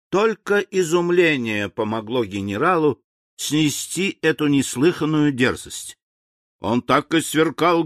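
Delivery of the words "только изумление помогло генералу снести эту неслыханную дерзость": plain reading voice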